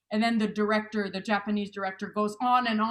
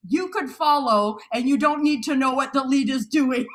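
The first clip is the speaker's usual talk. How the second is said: a louder, half-shouted announcement to the class; mock teacher shout; 4 semitones higher, 7 dB louder than usual. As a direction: proclaim it to the whole room. Russian teacher addressing the class.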